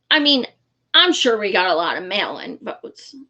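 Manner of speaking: usual speaking voice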